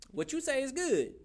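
high, lightly voiced crowd agreeing. Preacher voices the people answering Elijah, high and eager.

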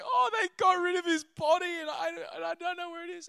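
Mary tells Jesus the body is gone, distraught. Retold or imitated. Weeping Mary answers Jesus.

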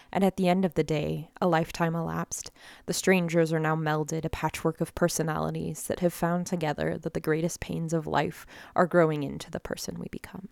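reading a poem aloud. Acted. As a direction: recite it like spoken word. Reads her poem aloud.

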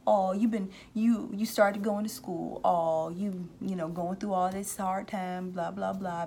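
high, sneering mock taunt. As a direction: taunt them like a bully who jeers. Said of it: "I can hear somebody mocking somebody".

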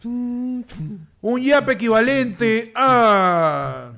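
very slow, stretched-out suspense build; quiz show host. Pretend. Host announces the prize over quiz music, drawn-out suspense. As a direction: stretch every word like a game-show host. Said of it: "Música de ¿Quién quiere ser millonario?".

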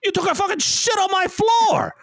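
high, mock-furious Irish shout; screaming Irishman. Imagined old Irish bar owner screaming at the culprit, mock Irish rage. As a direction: yell it in a bad, hammed-up Irish accent. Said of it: "some old Irish dude to come in there and scream at me".

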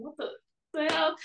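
retold high-pitched bewilderment; baffled disbelief. Confused by the mouse tragedy, retold bafflement. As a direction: replay a broken-off, incredulous reaction. Her baffled reaction to date.

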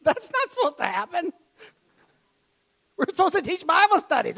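high, loud, near-crying pleading; hurt bewildered protest. Retold or imitated. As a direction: cry out how it was supposed to go. Retold shocked hurt protest.

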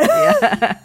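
yeah squeaked out high and loud mid-laugh. Real, not acted. Guest laughing at the host's quip, high squealing laugh. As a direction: burst into laughter on the word.

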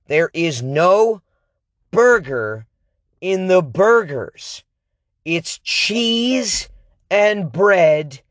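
stretched, indignant emphasis; slow, emphatic disbelief. Real, not acted. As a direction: spell it out slowly in outrage. Repeating the outrage slowly.

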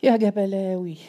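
cry from the depths. A narrator's reading. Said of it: "وهتف من أعماقه".

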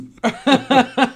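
high burst of laughter; loud laugh. Real, not acted. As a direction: cackle at the joke. Laughing at own joke.

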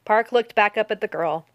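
plain reading voice

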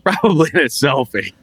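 ordinary delivery

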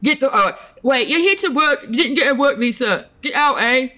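high-pitched Canadian border officer gone British; badly faked Canadian accent, drifting into British; botched Canadian accent. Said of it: "That was a British accent".

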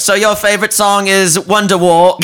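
high, polite awkward stranger impression; high mimic voice. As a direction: pipe it up like a socially awkward fan. Mimicking the odd guy.

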